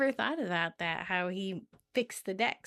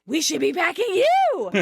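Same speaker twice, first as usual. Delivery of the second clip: high, loud mock outburst; hammed-up, raised-voice comeback; quarrelling; 7 semitones higher, 5 dB louder than usual. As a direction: throw it back as a heated retort. Acted roommate quarrel.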